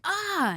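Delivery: high sharp yelp of pain. Retold or imitated. Retelling the bitten hero, a high pained yelp. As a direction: yelp like your lip was just bitten.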